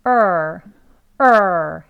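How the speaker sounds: er drawn out, rising then falling; long er. Shown as a demonstration. Teacher shows the long-vowel mark with a slow er. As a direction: stretch er up and let it drop. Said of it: "올라갔다 내려오는 거로".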